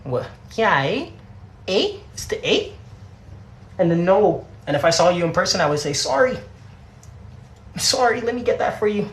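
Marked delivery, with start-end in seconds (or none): 0.5-2.7 high, questioning Canadian "eh"; Canadian tag question, pitched up. Shown as a demonstration. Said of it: "What, what gives it away that I'm Canadian". 5.9-9.1 high, polite Canadian "sorry"; Canadian politeness, pitched up; Canadian accent. Shown as a demonstration. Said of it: "all in the accent".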